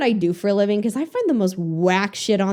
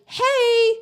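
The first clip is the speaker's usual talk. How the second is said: mock injector; 10 semitones higher, slower than usual. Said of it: "I don't see one, like".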